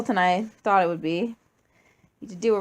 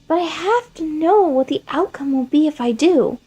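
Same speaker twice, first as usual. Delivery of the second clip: put-on high, loud childish curiosity; a kid who just has to know, high and loud; high eerie child voice; Flowey, curious child; 8 semitones higher, 7 dB louder than usual. Flowey justifies killing his father.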